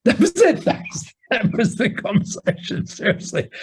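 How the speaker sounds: high, breathy laughter through the words; laughing. Real, not acted. Host laughing while telling the listener about the call, real laughter. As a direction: laugh it out in disbelief. Laughing at Simon's blunt advice.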